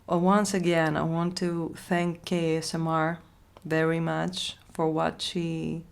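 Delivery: usual speaking voice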